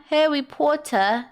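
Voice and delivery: drawn-out, put-on British accent; hammed-up British "Harry Potter"; mock British accent. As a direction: playfully stretch it in a fake British accent. Mock British echo of the title.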